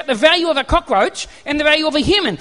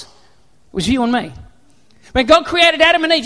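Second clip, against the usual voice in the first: ordinary talk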